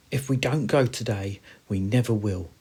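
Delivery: lightly voiced hamster, a touch eager; full of anticipation. Hamster urging friends to go today, mildly eager. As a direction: urge them on, slightly lifted. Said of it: "full of anticipation".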